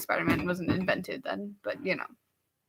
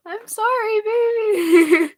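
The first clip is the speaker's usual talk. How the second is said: mock baby talk; 12 semitones higher, 4 dB louder, slower than usual. Comforting the sulking assistant.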